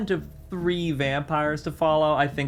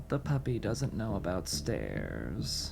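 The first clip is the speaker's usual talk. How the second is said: slow, soft, mocking sneer; sneering quiet taunt; 6 semitones lower, 9 dB quieter, slower than usual. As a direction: purr the insult low and slow. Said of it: "Pele cocks his head at you and he says".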